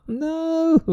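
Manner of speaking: very high, squeaky cry of no; disbelieving no. Real, not acted. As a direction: shriek it in disbelief. Roll fails again.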